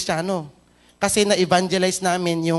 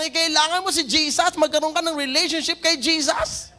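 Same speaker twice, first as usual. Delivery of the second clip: higher, urgent preacher's proclamation; full-force preaching; 10 semitones higher than usual. Acted. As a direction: drive the point home to the congregation.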